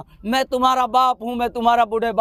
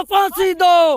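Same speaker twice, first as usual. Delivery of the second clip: loud, higher battle-cry slogan; challenging shout; 7 semitones higher, 4 dB louder, much slower than usual. Acted. Leader calling a war cry to the crowd, loud challenge. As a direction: hurl it out like a challenge. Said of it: "ललकार के बोलो".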